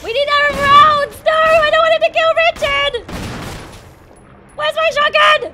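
high loud panicked yelling; real frantic shout. Real, not acted. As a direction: shout in a frenzy for ammo. Frantic boss fight.

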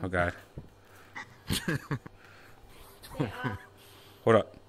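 ordinary delivery

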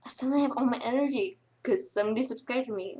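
ordinary talk

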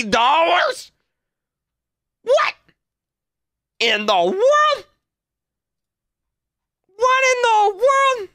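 high, slow squeals of disbelief; price shock. Real, not acted. Shopping for shoes and seeing the price, high slow disbelief. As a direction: yelp at the price tag, dragging each word. Sees shoe prices online.

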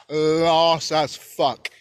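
very slow, stretched dismissal; drawn-out emphasis. Retold or imitated. Judging mom's boyfriend, drawn-out disbelief. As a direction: drag out "Lost" for effect.

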